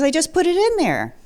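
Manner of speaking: high, incredulous retort; mock disbelief at her forgetting; imitating a baffled husband; exasperated.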